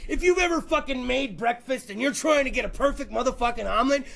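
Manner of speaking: normal talking voice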